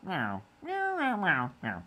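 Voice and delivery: squeaky high cat meows. Pretend. Streamer voicing his cat, high meowing. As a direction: meow up high like a kitten.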